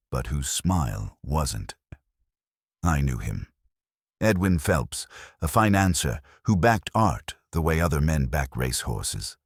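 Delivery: ordinary delivery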